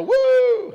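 high whooping cheer; mock cheer. Preacher imitating the crowd's whoop. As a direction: whoop it up like the congregation. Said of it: "We'd all go".

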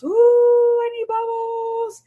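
high, slow drawn-out ooh; hammed-up need for bubbles. Shown as a demonstration. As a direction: stretch it out high like an overwhelmed parent. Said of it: "go in there and say".

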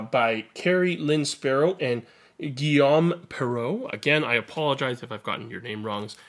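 ordinary delivery

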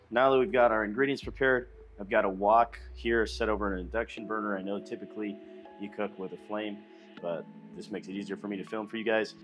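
normal talking voice

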